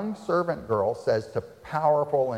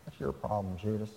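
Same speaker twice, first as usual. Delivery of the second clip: softer, cold brush-off; dismissive; 2 semitones lower, 8 dB quieter than usual. Retold or imitated. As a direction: shrug him off. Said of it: "Isn't that what they say?".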